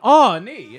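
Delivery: loud, high drawn-out "aw, nee"; mock cry broken in two; imitated strained cry. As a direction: groan it out loud, as if in labor. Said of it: "like they put, they put a hand on".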